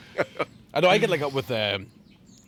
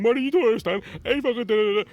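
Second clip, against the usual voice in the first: mock blubbering; 8 semitones higher, 4 dB louder than usual. Retold drunk crying plea.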